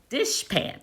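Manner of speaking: a high, hushed dishpan; high soft word demo. Shown as a demonstration. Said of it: "So with the word dishpan".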